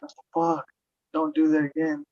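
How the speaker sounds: high-pitched retold complaint; retold protest. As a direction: re-enact an alarmed objection. Retells protest at repeated piercing.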